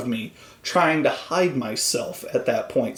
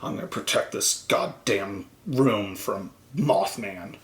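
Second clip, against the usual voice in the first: paranoid fanatic; 6 semitones lower, 6 dB quieter than usual. Retold or imitated. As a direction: mutter it like a crazed man guarding the room. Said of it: "actor grabs an axe and is like".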